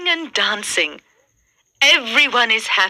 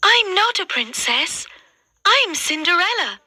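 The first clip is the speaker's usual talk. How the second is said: Cinderella's voice; 9 semitones higher than usual. A narrator's reading. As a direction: say it gently up high. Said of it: "she says".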